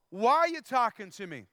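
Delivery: mock indignant woman at the well; sassy attitude. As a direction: challenge him, mildly indignant. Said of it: "She's giving him some attitude".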